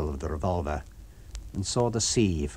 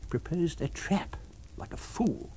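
a hint of bitter contempt, trailing off; scornful mutter; 10 semitones higher, 5 dB quieter than usual. Kemp mocks the fool upstairs, quietly scornful. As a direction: Kemp sneering at the invisible man's folly.

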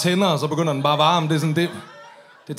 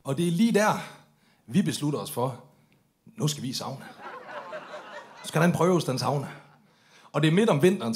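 normal talking voice

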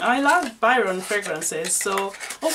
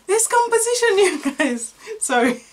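much higher, squeaky excited voice; giddy pitch jump as the perfume thrills her; funny high voice; 10 semitones higher, 4 dB louder than usual. Real, not acted. Perfume reviewer to her viewers, in a giddy high voice. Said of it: "I don't even know why I'm talking like that".

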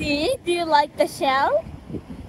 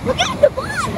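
excited call; 9 semitones higher, 8 dB louder than usual. Real, not acted. Calling out at the beach.

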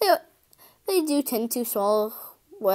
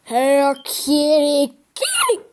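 louder call leaping to a squeal; forceful cat call; 3 semitones higher, 4 dB louder, slower than usual. Acted. Louder try at calling cat.

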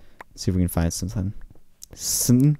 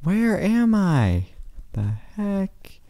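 high, slow, drawn-out confusion; slow bewildered; 12 semitones higher, much slower than usual. Real, not acted. Lost gamer wondering where he is, slow and high. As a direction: stretch it out, baffled.

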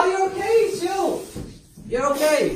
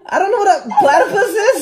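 laughing; 11 semitones higher than usual. Real, not acted. Giggling through game guesses.

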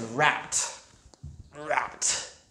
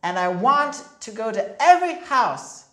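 put-on higher, louder little girl; eager little sister; 6 semitones higher, 5 dB louder, faster than usual. A narrator's reading. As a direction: insist on every house. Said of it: "said D W".

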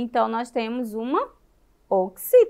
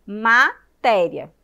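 "Matéria" slow and loud, split into ma, té-ria; syllable by syllable; 6 dB louder, much slower than usual. Shown as a demonstration. Teacher counting syllables with children, loud and split. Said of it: "Vamos contar aí".

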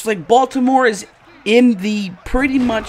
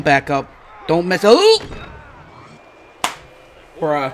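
normal talking voice

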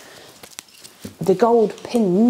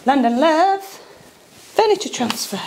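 higher, slow showcase of the name; proud announcement; 7 semitones higher, 3 dB louder, much slower than usual. Real, not acted. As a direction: present the brand name with pride. Proudly presents brand transfer.

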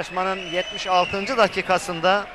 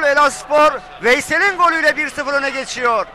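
screaming football commentator; 4 semitones higher, 6 dB louder than usual. Real, not acted. Announces the goal scorer.

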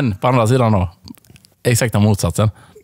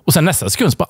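normal talking voice

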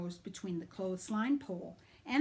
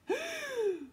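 sharp surprised gasp; high gasp. Acted. Mom seeing spacesuit, high shocked gasp.

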